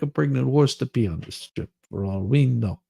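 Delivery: usual speaking voice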